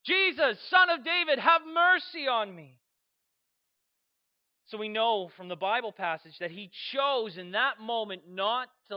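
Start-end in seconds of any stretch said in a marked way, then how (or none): 0.0-2.8 loud, high, drawn-out cry for mercy; full-voiced calling out from the roadside; desperate shout. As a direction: shout it out over a crowd, stretching the plea. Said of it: "he began to shout".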